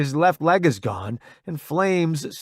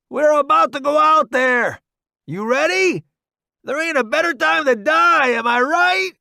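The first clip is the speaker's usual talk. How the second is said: high, loud cocky soldier; a grinning, reckless army buddy; brash soldier voice; 7 dB louder than usual. Acted. Roddy psyching up for battle.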